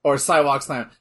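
normal talking voice

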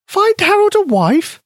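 very high, surprised mouse voice; a tiny mouse, taken aback; squeaky mouse voice. A narrator's reading. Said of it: "Penny repeated".

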